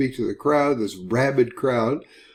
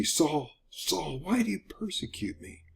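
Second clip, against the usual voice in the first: soft, higher-pitched voice from heaven; hushed, pleading question read aloud; hushed divine call; 4 semitones higher, 8 dB quieter than usual. Acted. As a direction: call out softly, as a voice from above. Voice from heaven in scripture reading.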